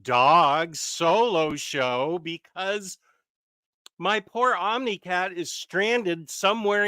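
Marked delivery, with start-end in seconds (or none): 0.0-2.2 loud, high, cheery hello to the stream; big show greeting. Real, not acted. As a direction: call it out like a host opening the show.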